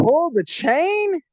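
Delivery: high, drawn-out baffled question; incredulous. Retold or imitated. Young man questioning the stranger's order, incredulous. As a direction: repeat it back in mock disbelief.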